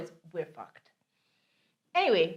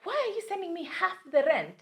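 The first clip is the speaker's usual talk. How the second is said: angry landlord; 10 semitones higher than usual. Retold or imitated. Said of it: "I'm like".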